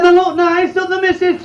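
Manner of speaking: high-pitched, polite customer asking. Acted. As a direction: play the shy customer up high.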